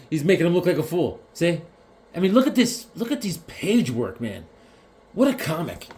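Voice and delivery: normal talking voice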